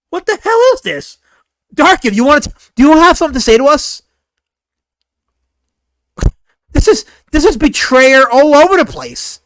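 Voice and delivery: loud, high-pitched confrontation; shouted comic indignation; outraged disbelief. Real, not acted. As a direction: demand answers at full voice. Confronting Dark Gift over picture.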